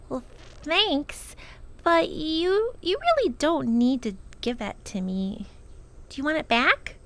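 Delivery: put-on a girl unsure of the gift; shy girl. Lindy answers shyly.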